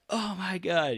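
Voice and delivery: high breathless oh my God; starstruck gasp. Retold or imitated.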